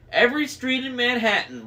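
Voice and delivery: high-pitched hag's boast; bragging. A narrator's reading. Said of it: "Wasp bragged".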